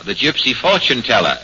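ordinary talk, nothing marked

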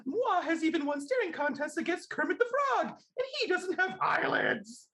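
very high diva puppet falsetto; Miss Piggy. Shown as a demonstration. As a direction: squeal it out like a pig diva, reading in character. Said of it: "I'm gonna try this quick Miss Piggy".

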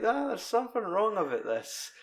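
high, wary voice; doubting toy in a high voice; suspicious toy. Retold or imitated. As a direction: sniff out the lie in a high voice. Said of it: "And he's like".

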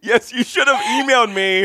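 high, laughing through the words; playful mock reproach. Host jokes to guest about wanting questions sent ahead, laughing mock reproach.

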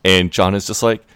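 normal talking voice

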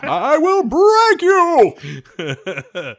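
loud, slow, high hammy menace; Ivan Drago voice. Retold or imitated. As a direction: drag out the threat, laughing. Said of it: "Ivan Drago".

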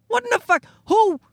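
incredulous outburst. Real, not acted. Comic recalling being taken for a creep, high incredulous yelp. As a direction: squeak out your disbelief.

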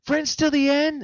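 high, sing-song doll plea; creepy doll voice. Retold or imitated. Retelling Chucky begging Andy, high creepy doll voice. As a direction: coax like a pleading toy. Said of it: "he's all like".

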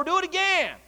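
high-pitched repeat command; called-out order. Retold or imitated. Elijah ordering the water poured again, called-out order. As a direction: call it out, pitched well up.

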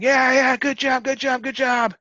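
high, loud mock crowd cheer; hooting and hollering. Racers cheer him over the obstacle, imitated hollering. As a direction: imitate a crowd whooping you on. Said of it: "everybody's hooting and hollering".

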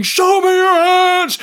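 shouted order, high and slow; one cop screaming an order at a suspect. Retold or imitated. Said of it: "One cop is screaming".

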